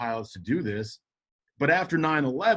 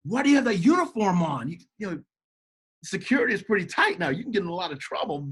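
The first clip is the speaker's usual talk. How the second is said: high, worried protest from a friend, a touch urgent; Tony warning Pat he'll get in trouble, in alarmed mock voice; 5 semitones higher than usual. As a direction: stop him, he's worried for you. Tony warning about the uniform.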